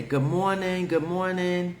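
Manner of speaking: bright high sing-song good morning; playful imitation of a sunny office greeting; mock chirpy greeting. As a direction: sing it out like an overly cheerful coworker. Mock cheerful greeting to coworker.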